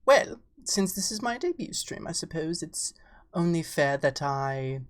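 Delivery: normal talking voice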